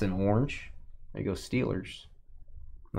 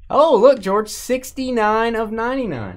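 excited; 10 semitones higher, 6 dB louder than usual. Acted. Hyping a card.

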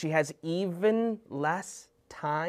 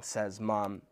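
ordinary delivery, nothing marked

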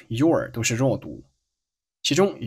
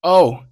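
Oh drawn out and louder; a punched, higher "Oh"; stress on Oh; 5 semitones higher, 8 dB louder than usual. Shown as a demonstration. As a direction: hit "Oh" hard with raised volume. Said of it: "这个Oh是做了重读".

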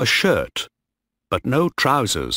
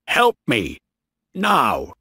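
urgent appeal, less than a full shout; shouted plea; 3 semitones higher, slower than usual. A narrator's reading. Captain calling on his crew for help, urgent. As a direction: call for help insistently. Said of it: "Bligh shouted".

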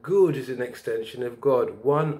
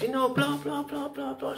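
mimicking woman; 13 semitones higher, 5 dB quieter than usual. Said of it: "the Jamaican woman says".

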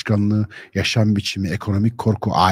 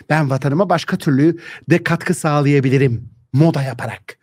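a hint of mocking effeminate youth; 2 semitones higher than usual. Mocking young man with mascara.

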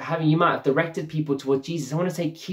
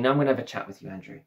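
gentle reassurance; 4 semitones lower, 5 dB quieter, much faster than usual. Retold or imitated. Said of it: "Jesus is really compassionate".

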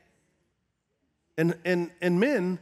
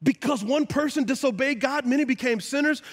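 ordinary talk, nothing marked

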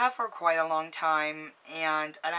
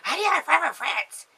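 high, mocking jealous friend voice; 10 semitones higher, 7 dB louder, faster than usual. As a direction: mimic a friend's envious whine.

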